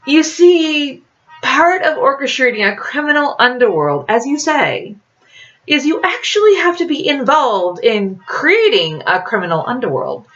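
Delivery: smug criminal explaining, lightly voiced; a hint of a sly charmer talking his way through; lightly voiced sly schemer. A narrator's reading. Edie explains his underworld.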